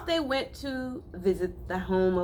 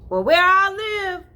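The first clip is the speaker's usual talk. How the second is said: boastful; 14 semitones higher, 10 dB louder than usual. Acted. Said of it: "boasted the City Mouse".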